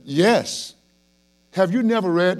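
high, ringing rebuke to accusers; emphatic retort. Acted. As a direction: answer back firmly and forcefully. Voices Jesus answering the priests.

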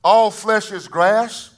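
loud, high declaimed question and answer; prophet's proclamation. Acted. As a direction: cry out the verse with weight. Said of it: "he said".

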